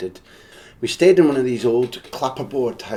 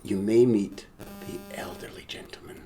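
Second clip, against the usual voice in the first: hushed quote; 7 dB quieter than usual. Retold or imitated. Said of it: "said to us".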